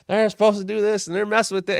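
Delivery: high, loud angry outburst, imitating Earl; mock a referee fuming over the match; furious rant. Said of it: "he's so pissed off about something".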